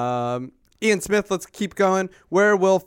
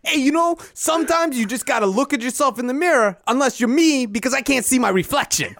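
vampire coach voice; 7 semitones higher than usual. Retold or imitated. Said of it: "get Billy Donovan on the phone".

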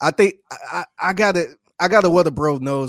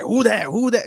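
New Orleans chant, higher and louder; New Orleans accent; 8 semitones higher, 4 dB louder than usual. Retold or imitated. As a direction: imitate a New Orleans local calling out. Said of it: "and then she like".

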